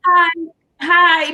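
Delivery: high repeated cheerful hi; chirpy greetings. Retold or imitated. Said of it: "I'm the person that's in the store".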